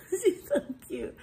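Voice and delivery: high, loud laughing gush; delighted laugh. Real, not acted. Mother delighted by her baby.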